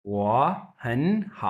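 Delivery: 我很好 stretched very slowly, 我 and 很 rising, 好 dipping; drawn-out model for repeating; model reading. Shown as a demonstration. Teacher giving students a line to repeat, very slow. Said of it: "इसे मेरे साथ pronounce कीजिए".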